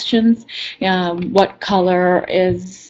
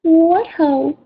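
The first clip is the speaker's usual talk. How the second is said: meek voice; 8 semitones higher than usual. Acted. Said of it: "In a meek voice".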